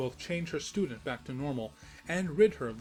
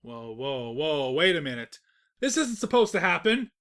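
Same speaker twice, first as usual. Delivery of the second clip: louder, rising panicked protest; alarmed protest; 9 dB louder than usual. Acted. The narrator panics as Celestia plans to trap him, loud alarm. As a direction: stop them in a panic.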